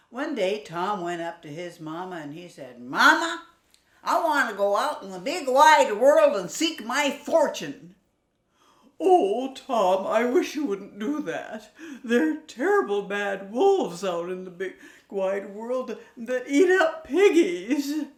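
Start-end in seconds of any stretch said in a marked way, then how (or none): 2.8-7.9 high, loud little piglet voice; a bold young pig begging his mama; high excited piglet; eager little pig. Acted. 9.0-18.0 high, loud, fretting mother sow; a mama pig begging her son to stay; high anxious mother pig with a tearful laugh; worried mama pig. Acted.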